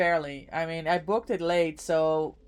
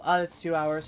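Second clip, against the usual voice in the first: normal talking voice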